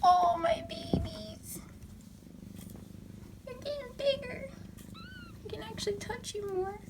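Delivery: high, soft cooing whisper to the kittens. Real, not acted. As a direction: coo softly up high like greeting newborns.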